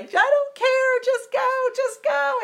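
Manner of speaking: very high, desperate acted wail; screaming and crying. Crying daughter begging friend to drive, hysterical scream. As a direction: re-enact a hysterical plea, voice way up high. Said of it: "I was screaming, and I was crying".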